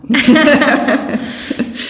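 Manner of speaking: high bright burst of laughter; a loud laugh at their own seriousness; big laugh. Real, not acted. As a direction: laugh it off, amused. Laughing at getting too deep.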